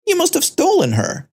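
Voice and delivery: a sudden high accusing jab from the woman; accusing shriek. Acted. Stranger in the mall accusing the teen of stealing the baby, high accusing cry. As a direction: spit the accusation out, pitch leaping up.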